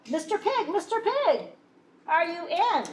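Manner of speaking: high sing-song call; wolf calling at the stick house. Acted. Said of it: "I called".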